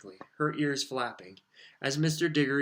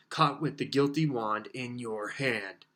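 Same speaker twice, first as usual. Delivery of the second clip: usual speaking voice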